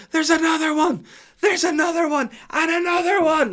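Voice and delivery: shrieking child impression, very high and loud; Holly. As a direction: scream each alarm like a frantic little girl. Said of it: "And then Holly starts".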